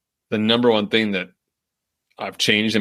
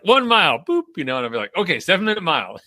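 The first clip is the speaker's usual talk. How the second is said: much higher, bouncy sing-song; chirpy self-talk; 9 semitones higher than usual. Retold or imitated. Runner retelling her mid-run watch chatter, higher and chirpy. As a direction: chirp it like a cheerful little cue to yourself.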